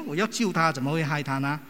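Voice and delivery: ordinary talk, nothing marked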